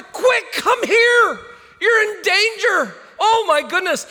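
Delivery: put-on panicked parent calling the child in; yelling in fear. Parent yelling to child riding in street, frightened. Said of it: "they yell out of fear and concern".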